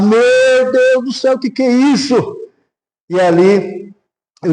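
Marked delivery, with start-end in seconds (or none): 0.0-2.2 imitated mother's cry. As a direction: stretch out a mother's amazed cry, without full volume. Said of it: "a mãe dela gritou do outro lado". (grito imitado de mãe, agudo e arrastado)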